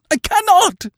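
high, loud anguished cry; wailing. Acted. Sister refuses to kill her brother, loud anguished wail. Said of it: "she wailed".

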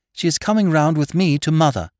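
high, loud, decisive announcement; determined. A narrator's reading. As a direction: settle the matter firmly. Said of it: "said Lady Mary determinedly".

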